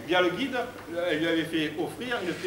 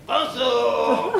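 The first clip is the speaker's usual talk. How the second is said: loud, high hearty call; hailing shout; 6 semitones higher, 8 dB louder than usual. Acted. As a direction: holler it across the whole group. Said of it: "Et elle fait". (cri d'appel sonore)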